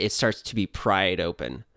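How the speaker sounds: ordinary talk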